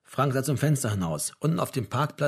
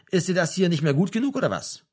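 higher, reproachful challenge; mildly aggressive question, dropping at the end; 6 semitones higher, 3 dB louder than usual. A narrator's reading. Mother challenging her son about home, mildly aggressive. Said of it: "fragte seine Mutter aggressiv".

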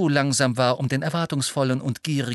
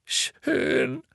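sobbing. A narrator's reading. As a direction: give in through sobs. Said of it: "schluchzte erneut". (leise schluchzendes „Schön“)

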